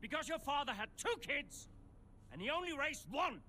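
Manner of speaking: high, loud angry blow; shouted retort. Acted. Hitting back about the father raising one kid, shouting. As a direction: yell it back to wound.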